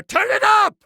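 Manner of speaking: high-pitched excited yell; sudden high villain shout; shouted command. Acted. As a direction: shout it as an eager order.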